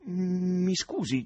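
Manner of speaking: lento, acuto, diffidente; con sospetto. Acted. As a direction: scandisci piano, sospettoso. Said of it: "disse con sospetto". (Nikanor diffida dello sconosciuto, tono sospettoso e lento)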